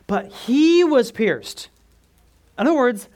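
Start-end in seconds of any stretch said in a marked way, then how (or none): 0.1-1.7 "he" hit high and loud, the rest slow; stress on he. Shown as a demonstration. Preacher showing the congregation the stressed "he", slow and loud. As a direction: lean hard on the word "he". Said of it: "that word he right there is emphasized".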